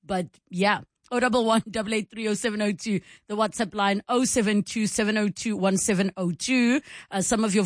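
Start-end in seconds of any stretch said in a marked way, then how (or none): none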